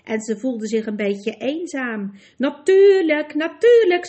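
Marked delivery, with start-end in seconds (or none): 2.4-4.1 loud, higher-pitched hasty assurance. A narrator's reading. Horse rushing to reassure his companion, hasty and loud. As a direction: cut in hurriedly, raising pitch and volume. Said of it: "zei Brie haastig".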